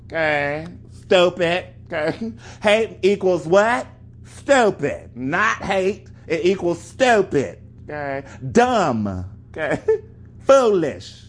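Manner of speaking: gleeful shouted verdicts, pathetic drawn out slow; mocking call-outs. Real, not acted. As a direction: fire each insult like a punchline. Calls out answers with laughs.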